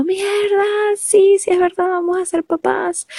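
high, thrilled reaction; high excited announcement; excited disbelief. Retold or imitated. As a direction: squeal it with joy. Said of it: "fue como".

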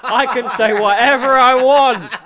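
loud, high-pitched defiant yell; defiant shout. Real, not acted. Podcaster defending his ranting to his co-host, defiant shout. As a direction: shout it out over the laughter. Defiant retort after teasing.

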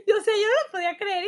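fast, loud and high through laughter; laughing disbelief. Real, not acted. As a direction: rush it out, laughing in amazement. Still amazed at the boss's reply. (entre risas, rápido y agudo)

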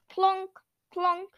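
clonking car noise. A narrator's reading. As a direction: imitate a broken car clonking. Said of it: "making loud clonking noises".

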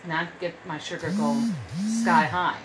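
ordinary delivery

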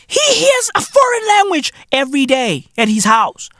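ordinary talk

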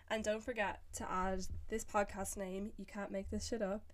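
soft, measured sponsor read; podcast ad voice, well under chat volume; recorded ad read. Acted. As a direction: read the sponsor copy quietly and evenly.

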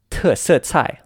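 "特色菜" high, falling hard on each syllable; emphatic fourth tones. Shown as a demonstration. Teacher puts the whole question together, emphatic fourth tones. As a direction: punch "特" first, then "色菜" falling. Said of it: "have a stronger emphasis".

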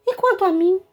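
grumbling. Acted. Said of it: "resmungou o príncipe".

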